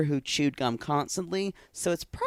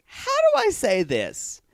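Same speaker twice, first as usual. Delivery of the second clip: higher, hesitant mock pondering; imitating Darcy; 9 semitones higher than usual. Host voicing Darcy hunting for words, hesitant. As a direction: pitch it up, searching for words. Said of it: "you could see Darcy say".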